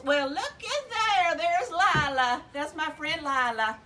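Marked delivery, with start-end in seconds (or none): none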